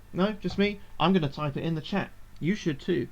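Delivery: ordinary delivery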